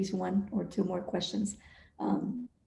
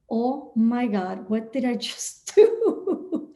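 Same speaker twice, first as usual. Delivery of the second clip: slow, drawn-out dismay rising into a laugh; stunned disbelief; 3 semitones higher, much slower than usual. Retold or imitated. Boss hanging up after promising employees a raise, stunned disbelief. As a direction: stretch it out, amazed at yourself, and laugh.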